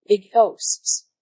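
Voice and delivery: plain reading voice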